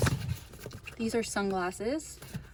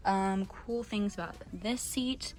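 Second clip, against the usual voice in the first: usual speaking voice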